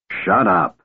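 ordinary delivery, nothing marked

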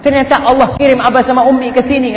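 high-pitched, mock-emotional gushing; imitating tearful daughter. Wife covering up the quarrel before visiting parents, imitated tearfulness. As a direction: play the daughter covering tears with longing. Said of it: "nangis tuh perempuan".

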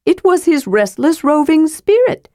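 sly kender explaining the way to the rune; Tass the kender. Acted.

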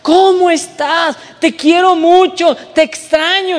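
mushy friend gushing fondness; sappy friend. Retold or imitated. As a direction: pour on the sweetness like the mushy friend. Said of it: "que es bien meloso".

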